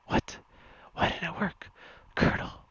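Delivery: alarmed but still in whisper; whispered panic. Acted. Snake calls his colonel when the grenade fails, whispered panic.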